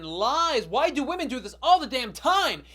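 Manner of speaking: loud, high-pitched ranting yell; exasperated outburst. Acted. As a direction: yell it out in comic outrage. Outburst at wife's "nothing's wrong.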